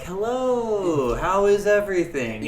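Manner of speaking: high, sing-song greeting to diners; chirpy host greeting. Retold or imitated. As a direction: put on a bright customer-service voice for the table. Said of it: "go out to table three and be like".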